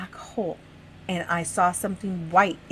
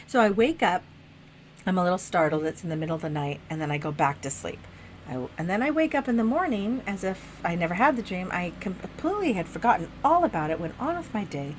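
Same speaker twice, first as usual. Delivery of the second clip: ordinary talk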